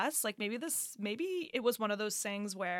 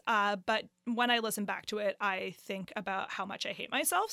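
usual speaking voice

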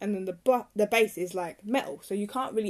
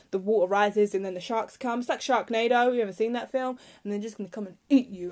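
ordinary talk

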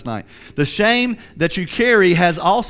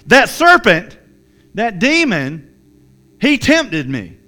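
raised, indignant Eve; Eve blaming; 4 semitones higher, 6 dB louder, slower than usual. Acted. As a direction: point the finger at the serpent, louder. Eve voiced blaming the serpent.

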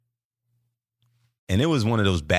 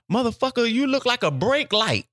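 high-pitched mocking heckle; imitating a heckler, high voice; retold heckle; 13 semitones higher than usual. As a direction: call out the insult from the crowd, pitched way up. Retold heckle shouted from the crowd.

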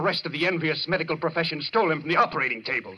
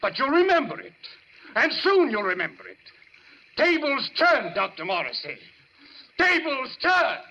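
menacing warning; 6 semitones higher than usual. Acted. Patient threatening his doctor, rising into wild raving. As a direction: warn him, then let the madness break loose.

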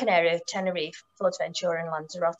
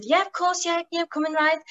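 eager yes; 9 semitones higher than usual. Retold or imitated. Eager yes to first customer.

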